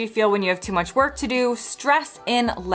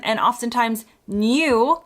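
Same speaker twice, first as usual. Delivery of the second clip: "new" as nyoo with a y glide; British "new", nyoo; slower than usual. Shown as a demonstration. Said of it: "in a British accent".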